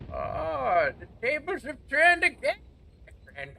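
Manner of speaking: put-on high, loud British old-man crowing, dropping at the end; mock British old man. Gamer gloating as the fight turns, mock British old-man voice. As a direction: crow like a hammy British wizard. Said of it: "I'm mixing an old man voice".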